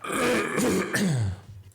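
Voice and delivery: long rough throat clear. Real, not acted. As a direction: clear a tired, sore throat. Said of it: "I'm losing my voice".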